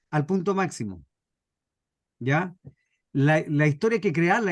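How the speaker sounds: ordinary talk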